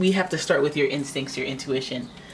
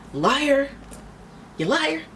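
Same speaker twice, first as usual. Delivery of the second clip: mock accusing; 9 semitones higher, slower than usual. Querent catching the swindler, hammed-up anger. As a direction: call them out, playing it big.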